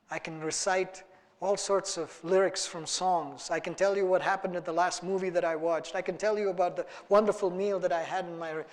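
normal talking voice

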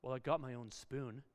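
put-on low, soft kid's grumble; under the breath. Child answering dad's challenge, muttered under the breath. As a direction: imitate muttering a small self-defence. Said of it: "that person under their breath says".

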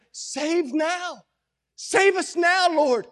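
high, urgent pleading cry; crowd shouting out. Acted. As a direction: cry out to the Lord like a beseeching crowd. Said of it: "That's what they're shouting out".

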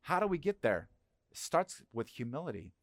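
usual speaking voice

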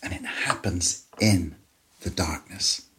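grave, hushed sermon delivery; hushed solemn preaching. Acted. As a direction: let each phrase sink into silence. Somber climax of crucifixion.

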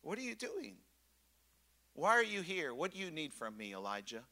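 soft, caring invitation; tender. Shown as a demonstration. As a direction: ask it like a caring father. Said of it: "God was saying".

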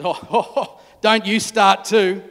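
laughing in disbelief; exasperated laugh. Acted. Mary laughing at Jesus, exasperated. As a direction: laugh it off, fed up. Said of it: "She says".

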